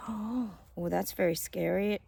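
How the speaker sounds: ordinary delivery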